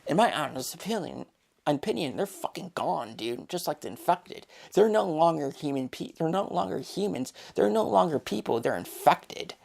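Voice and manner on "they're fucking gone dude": ordinary talk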